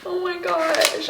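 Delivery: high, slow, tearful oh my gosh; a wistful sigh of a phrase; moved. Real, not acted. Still moved by her sorority letters, tearful and high.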